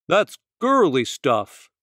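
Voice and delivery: a touch of a scornful bear snort; Young Bear scoffs at the girls' ideas. Acted. Young Bear mocking the hula hoop and jump rope ideas, a scornful snort. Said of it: "Young Bear snorted".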